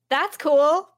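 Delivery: loud, high underwhelmed "that's cool"; deadpan reaction. Retold or imitated. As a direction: act totally unimpressed, loud and high. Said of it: "my reenactment of my reaction".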